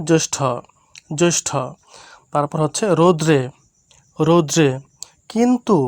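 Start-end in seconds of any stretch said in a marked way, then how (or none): 0.1-1.5 জ্যেষ্ঠ said as "josh-tho"; teacher models জ্যেষ্ঠ as "joshtho", twice; model pronunciation. Shown as a demonstration. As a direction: round the first vowel of জ্যেষ্ঠ to "jo". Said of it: "এটার উচ্চারণটা হবে".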